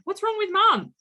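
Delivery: high, loud bewildered mum; mock exasperated mum. Mum exasperated at ignoring kids, high and loud. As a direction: toss it off like the clueless family in the meme. Meme punchline, mum losing it.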